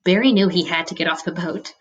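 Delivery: usual speaking voice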